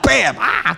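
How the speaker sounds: comic burst with a playful cry; mock explosion. Preacher joking his fingernail blew up, mock explosion noise. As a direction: imitate a small blast and yelp. Imitates fingernail blowing up, laughing.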